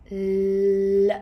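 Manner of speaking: "l" held long, loud and level; isolated L sound. Shown as a demonstration. Teacher showing the beginning consonant, held L sound. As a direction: sustain "l" as one steady consonant. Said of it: "sound, or like L in English".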